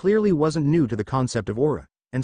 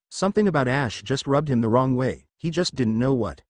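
usual speaking voice

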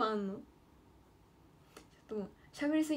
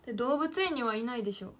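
normal talking voice

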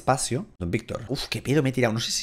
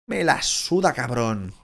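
usual speaking voice

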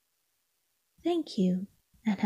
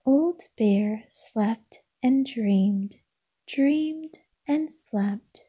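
slow dreamy reading; 3 semitones higher, slower than usual. Acted. Sleepy lull in bedtime story.

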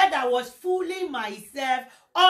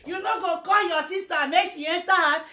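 higher, louder mocking chant; mocking callers; 6 semitones higher, 6 dB louder than usual. Gossipers mocking the speaker through her friend. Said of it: "calling me".

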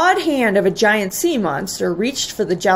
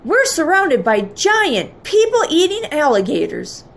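alarmed cry; 7 semitones higher than usual. A narrator's reading. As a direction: warn them with storybook dread. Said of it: "Father cried".

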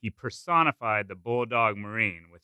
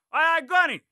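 platoon shouting; 4 dB louder than usual. A narrator's reading. Platoon answering sergeant.

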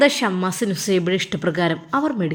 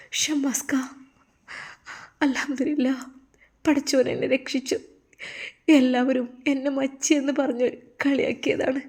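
higher, softer, crying with relief; tearful joy; 4 semitones higher, 5 dB quieter than usual. Acted. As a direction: sob out her gratitude to her husband. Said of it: "കെട്ടിപ്പിടിച്ച് കരഞ്ഞു". (ഭർത്താവിനോട് ആശ്വാസത്തോടെ കരഞ്ഞ് പറയുന്നു)

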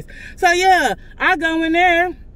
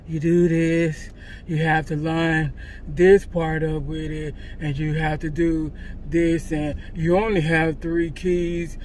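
much lower, slow, dull mocking drone; dry clerk imitation; 8 semitones lower, 4 dB quieter, slower than usual. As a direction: drag it out like a bored clerk explaining a lock. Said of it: "That's how fucking dry he was".

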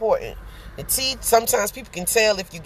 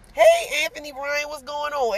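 cheery greeting; 7 semitones higher than usual. Real, not acted. Greeting viewer in chat.

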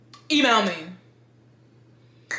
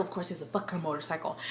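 ordinary delivery

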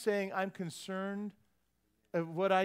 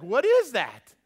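loud, higher startled question; 6 semitones higher, 13 dB louder, slower than usual. Retold or imitated. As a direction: blurt it out loud and bewildered.

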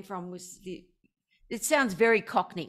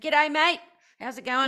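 hearty Australian greeting, pitched up; loud Australian hello; Aussie accent; 5 semitones higher, 5 dB louder than usual. Shown as a demonstration. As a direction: lay on the Aussie accent brightly. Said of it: "I've still got this Aussie accent".

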